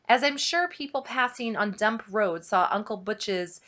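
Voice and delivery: usual speaking voice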